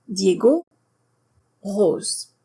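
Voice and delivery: "Diego, Rose" with a pure single o; "Diego, Rose" the French way, no glide. Shown as a demonstration. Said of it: "one sound in French".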